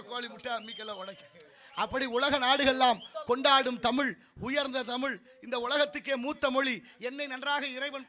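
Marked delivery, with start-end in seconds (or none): 1.7-8.1 loud, proclaiming stage voice; oratory. Acted. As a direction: hail the language before a crowd. Breaks into formal oration. (உரத்த மேடை முழக்கம்)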